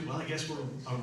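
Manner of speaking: normal talking voice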